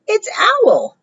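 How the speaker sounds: very high mouse voice; high-pitched announcement; chirpy little mouse. Acted. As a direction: pipe up high as a small storybook mouse.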